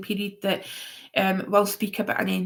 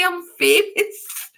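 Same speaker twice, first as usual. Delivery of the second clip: higher and slow, savoring it; mock grand boast; 8 semitones higher, 5 dB louder, slower than usual. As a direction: play the celebrity for a laugh. Mock boast about the troll.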